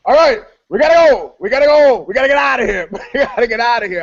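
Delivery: high, loud, rushed we-gotta-go panic; panicked retold urge to flee. Telling the guitar player they must leave, in high rushed panic. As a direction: hurry your bandmate out in mock alarm.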